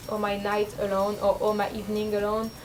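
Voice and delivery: ordinary talk, nothing marked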